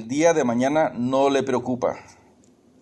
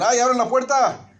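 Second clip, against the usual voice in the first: high, frantic voice of a husband juggling the milk; panicky husband; 10 semitones higher than usual. Retold or imitated. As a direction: squeal it out like a flustered husband. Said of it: "y dicen". (esposo agobiado que grita por ayuda, voz aguda y frenética)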